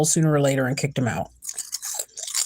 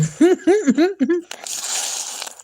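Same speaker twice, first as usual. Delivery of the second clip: sheepish laugh; 13 semitones higher than usual. Real, not acted. Laughing at own crunching.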